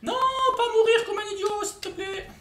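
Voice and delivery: high, strained begging; anxious plea. Real, not acted. Player pleading with himself not to die stupidly, high.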